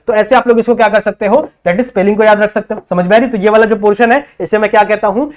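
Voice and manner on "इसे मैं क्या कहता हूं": normal talking voice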